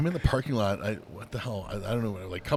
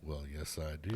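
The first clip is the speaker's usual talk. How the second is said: low, soft, resigned admission; sheepish low aside; resigned answer; 6 semitones lower, 10 dB quieter than usual. Retold or imitated. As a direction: answer yourself quietly, giving in. Meek answer to own question.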